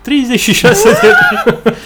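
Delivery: high, loud words breaking into laughter; slow, squealed disbelief through laughs; laughing incredulously. Real, not acted. Co-host hears of thirty-six beers, laughing loudly.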